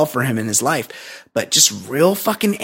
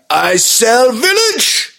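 higher, slow broken-English villain voice; mock Sato; 8 semitones higher, much slower than usual. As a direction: drag out each word like a cartoon villain. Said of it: "And he's like".

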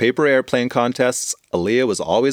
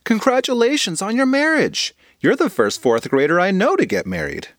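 put-on high eager kid voice; high bubbly girl congratulating her friend; excited young girl; 9 semitones higher than usual. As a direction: gush like a fourth grader. Aaliyah voiced by narrator.

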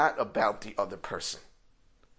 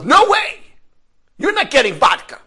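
much higher and louder, an angry shout; furious refusal; 18 dB louder than usual. Retold or imitated. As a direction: slam the door on the idea. Inner refusal, hotel owner furious.

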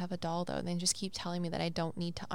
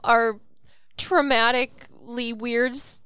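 loud, scornful mock of a doubter; mocking skeptic; 4 semitones higher, 9 dB louder than usual. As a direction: sneer like an online critic. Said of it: "They're gonna be like".

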